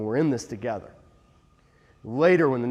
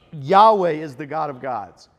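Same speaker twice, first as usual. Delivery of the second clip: loud, slow, emphatic proclamation; emphatic confession; 7 dB louder than usual. Retold or imitated. The tribes confess their God to Israel, emphatic. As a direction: proclaim it slowly with weight on "Yahweh". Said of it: "So they're saying emphatically".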